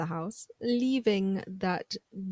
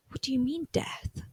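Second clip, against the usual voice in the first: whispered disbelief; 4 semitones higher, 6 dB quieter than usual. Retold or imitated.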